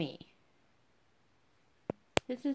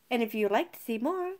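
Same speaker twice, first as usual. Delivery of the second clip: normal talking voice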